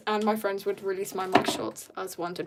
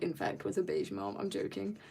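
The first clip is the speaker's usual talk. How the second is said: ordinary delivery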